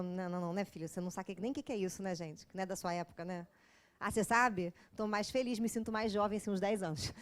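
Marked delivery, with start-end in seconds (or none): 0.0-3.5 put-on lower, softer teasing young voice; mock youngster. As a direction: tease like a kid from the audience. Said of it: "pessoa novinha ali que falou". (jovem da plateia zombando, mais baixo)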